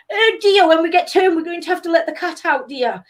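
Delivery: high-pitched, hammed-up posh English; mock posh accent. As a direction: mimic snooty diners in a high posh voice. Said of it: "I just started ripping into these people".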